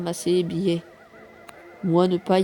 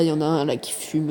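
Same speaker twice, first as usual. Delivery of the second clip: ordinary talk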